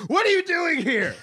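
high, loud mock outrage; surprised. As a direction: act out the shock of a startled host. Retold host meets surprise cousins.

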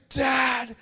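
high, loud mock outrage from the kids; horrified sons. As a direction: imitate scandalised boys scolding their dad. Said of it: "my boys look at me in absolute horror".

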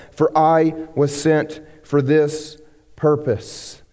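slowed right down, each word weighed; slow emphasis. Acted. Pastor landing Jesus's purpose line slowly. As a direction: land the key line slowly. Key verse, read slowly.

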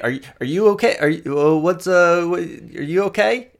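high, halting questions; flustered concern. Acted. Woman asks strangers if they are okay, flustered. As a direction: check on them, pitched well up and stumbling.

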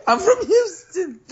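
squeaky high mimicry through laughter; laughing, exaggerated high copycat whine; mocking echo. Mimics friend's outburst while laughing.

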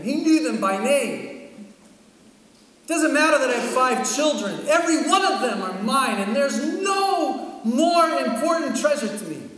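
loud, high fervent declaration; preaching at full force. Acted. Pastor declaring his love for his kids, loud fervent preaching. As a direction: proclaim it to the congregation.

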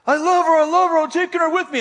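high, loud declaration; retold yelling. Pursuer yells at fleeing girl as he chases her, high urgent yelling. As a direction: call it out high and forceful. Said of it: "he's yelling something".

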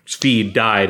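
ordinary talk, nothing marked

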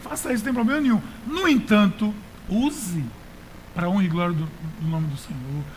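ordinary talk

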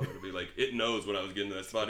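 ordinary talk

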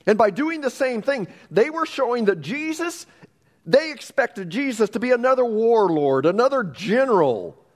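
impassioned preacher; preaching at full force. Real, not acted. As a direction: press the point to the crowd. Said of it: "preaching climax".

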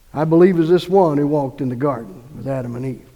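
normal talking voice

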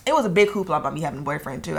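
normal talking voice

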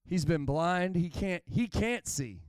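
ordinary talk

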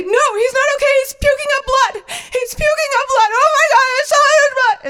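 very high, loud panicked screaming; hysterical sister. Retold or imitated. Sister screams that the dog is puking blood, hysterical. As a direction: shriek it down the phone in mock hysteria.

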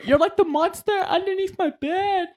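very high put-on kid voice; high-pitched, hammed-up little-kid fear; mock scared child. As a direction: squeal it like a kid afraid at bedtime. Continuing mock scared-child bit.

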